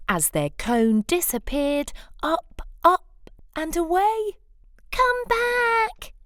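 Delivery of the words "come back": very high call after the bird; squeaky shout. Acted. Cyril shouting after the bird stealing the cone. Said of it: "shouted Cyril".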